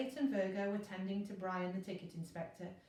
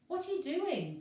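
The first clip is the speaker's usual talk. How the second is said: higher, louder sharp demand; 8 semitones higher, 5 dB louder than usual. A narrator's reading. Virgo demanding to know what Elliot is doing, sharp. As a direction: insist on an answer. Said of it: "demanded Virgo".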